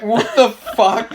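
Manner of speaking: high, slow, laughing disbelief; loud retold disbelief. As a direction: re-enact a stunned outburst, breaking into laughter. Retelling his baffled reaction to Lockie.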